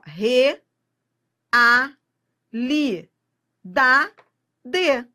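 Re, a, li, da, de split and punched one at a time; syllable by syllable. Shown as a demonstration. Teacher splitting realidade for students, loud separated syllables. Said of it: "Então, vamos, ó".

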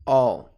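I'll pronounced "all"; one loud, low syllable, "all"; reduced contraction. Shown as a demonstration. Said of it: "Essa é a pronúncia".

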